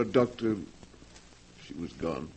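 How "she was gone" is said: hushed, grave. Acted. Reporting sister's death.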